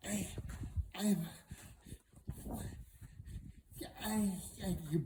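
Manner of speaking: choked stammer with long gaps; struck speechless. Acted. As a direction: try to speak and fail on each word. Princess struck speechless by the shoe.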